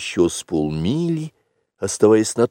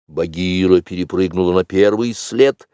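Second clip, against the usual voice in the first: usual speaking voice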